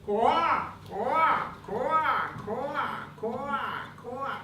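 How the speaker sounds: high croaking quoi; frog voice. Acted.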